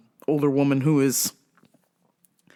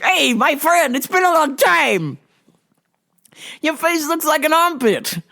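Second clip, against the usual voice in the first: higher-pitched mock-hearty hail; lightly voiced pushy greeter; 10 semitones higher than usual. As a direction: imitate an overfamiliar visitor, pitched up. Acting out a loud visitor's greeting.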